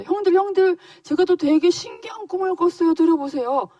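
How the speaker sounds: high, loud, excited little brother. Acted. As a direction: call everyone over with thrilling news. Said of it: "형들 또 막 불러서 또 얘기해서". (신나서 형들을 부르는 높은 목소리)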